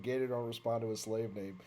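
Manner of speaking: low, soft deadpan reply; deadpan retort. Retold or imitated. Gator answers his boss, low deadpan. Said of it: "I was like".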